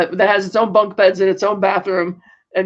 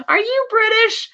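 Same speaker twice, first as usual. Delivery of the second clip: very high, slow delighted question; mock delight. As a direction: stretch it out high, thrilled by the guest. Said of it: "she's all delighted".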